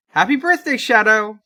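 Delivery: high and loud, a big birthday cheer; excited cheer. Acted. Friend cheering Shadow's birthday at the reading, excited and loud. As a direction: throw a party shout at Shadow.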